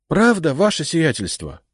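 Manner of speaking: high eager happy question; light up with delight; joyful. Acted. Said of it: "радостно спросил он".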